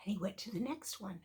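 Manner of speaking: usual speaking voice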